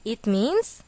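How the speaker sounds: ordinary delivery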